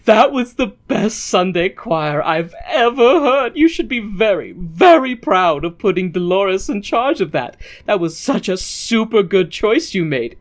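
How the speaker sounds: a mild hammed-up priest praising; gushing priest. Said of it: "the priest comes in and is like".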